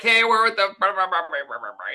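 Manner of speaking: imitating officers, starting high and trailing down; blah-blah imitation, dropping to a mumble; mock officer spiel. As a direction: babble off a cop's introduction, fading away. Imitating officers' tedious introduction.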